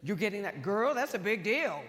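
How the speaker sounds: loud, laughing mock Barkley; Charles Barkley impressed. Barkley hearing about her award, loud laughing mock. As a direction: ham up his big impressed reaction. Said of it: "He goes".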